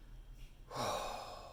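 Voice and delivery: long, soft exhale; heavy sigh. Retold or imitated. Actor hearing they booked the commercial, long relieved sigh. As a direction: let out a long breath of relief.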